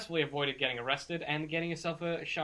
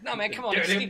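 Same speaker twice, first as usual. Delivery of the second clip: higher, begging addict voice; desperate junkie pleading in a raised pitch; pleading druggie; 7 semitones higher, 5 dB louder than usual. Acted. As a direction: beg to keep your stash. Game master voices the captive.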